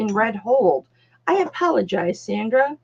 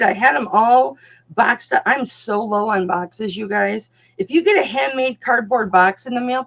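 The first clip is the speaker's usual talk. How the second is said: ordinary talk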